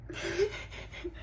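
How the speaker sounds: quiet, high breaking laugh; soft helpless tittering; stifled giggle. Acted. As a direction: let a small laugh escape helplessly. Breaking up over the memory.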